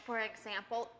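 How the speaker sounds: usual speaking voice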